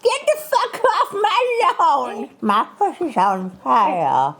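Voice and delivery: put-on high crackly old lady voice; granny voice. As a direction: play a cranky old granny. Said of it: "your best granny voice".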